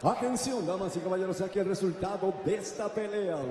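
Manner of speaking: booming bilingual ring announcer call. Acted. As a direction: announce it to the arena crowd. Ring announcer calls crowd.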